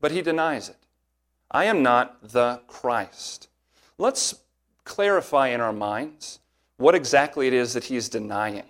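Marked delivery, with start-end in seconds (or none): 1.5-3.5 very slow, each word of "I am not the Christ." pressed down; slow, stressed "I am not the Christ."; emphatic denial. Retold or imitated. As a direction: spell out the denial slowly and firmly. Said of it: "He gives it in such an emphatic way".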